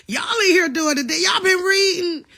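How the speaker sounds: high, loud, delighted shout; excited shout. Real, not acted. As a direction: cheer them on at full voice. Thrilled that viewers are reading.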